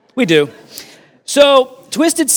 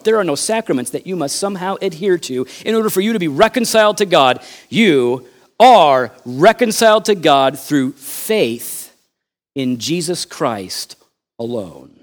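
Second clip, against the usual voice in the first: ordinary talk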